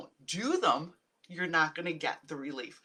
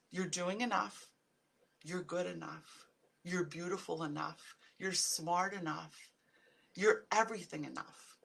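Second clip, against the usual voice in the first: softer, gentle, reassuring words; soft affirmations; 2 semitones lower, 8 dB quieter than usual. Real, not acted. Vlogger softly reassuring viewers they are enough, gentle affirmation. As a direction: comfort each viewer quietly.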